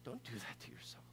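gentle whispered plea; a soft unvoiced aside. Real, not acted. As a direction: hush it, like comforting someone close. Tender aside to the grieving.

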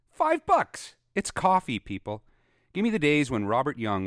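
high, loud shout on five bucks; outraged shout. Real, not acted. Ranting podcaster, outraged at coffee prices, shouts loudly.

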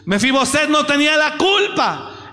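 shouted, high, rising sermon cry; fiery preacher exhorting the congregation, shouting; preaching at full force. Acted. Preaching climax.

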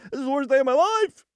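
very high, wailing whine; whiny negative voice. Pretend. Driver with a flat tire, moaning in a high wail. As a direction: wail it as the day falls apart.

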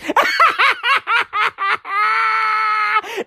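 high, helpless howling laugh; losing it completely, laughing hard; wild laughter. Real, not acted. Laughing at his own Herschel Walker impression, wild laughter.

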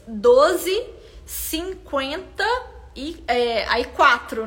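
slow, stretched count of the price; "Doze, cinquenta" pulled apart word by word; counting syllables slowly. Shown as a demonstration. Teacher counting a longer price's syllables for students, slow and stretched. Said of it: "nós temos sete sílabas".

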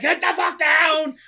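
high, loud, slowed mock yell; mock shout. Retelling the kidnap scene, hammed-up gunman shout. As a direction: bark it like a gunman in a raid.

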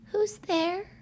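high, timid little voice; small voice. A narrator's reading. Albie hearing noises in the dark, small high voice. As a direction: ask it as a small nervous child. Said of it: "asked Albie in a small voice".

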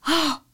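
two sharp, dramatic gasps; high, breathy "oh" gasps; shocked gasp. Retold or imitated. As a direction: gasp in horror, replaying the shock.

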